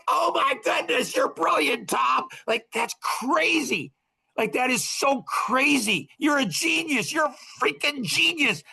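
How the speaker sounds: high squeaky gushing, held soft; acted amazement. Praising Tom for his insight, high-pitched hammed-up amazement. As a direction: gush in an awed, very high voice.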